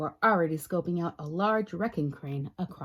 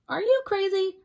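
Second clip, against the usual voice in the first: shocked protest; 12 semitones higher, 4 dB louder than usual. Acted. Spider protests.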